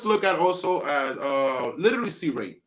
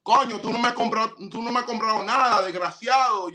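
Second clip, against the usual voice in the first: quick, higher-pitched Dominican complaint; Dominican Spanish; 4 semitones higher, faster than usual. Acted. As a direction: fire it off like a Dominican woman scolding. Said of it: "same girl would talk to you in a Dominican Spanish".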